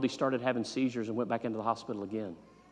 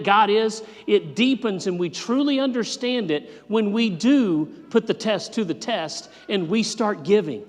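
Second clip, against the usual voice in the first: louder, higher preaching voice; preaching at full force; 7 semitones higher, 9 dB louder than usual. Acted. Pastor urging the congregation to give, full-force preaching. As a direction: drive the point home from the pulpit.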